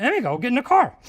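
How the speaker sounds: teacher ordering an exhausted student into the car, loud; urgent plea. Retold or imitated. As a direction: tell her to get in the car, insistent. Repeats the plea.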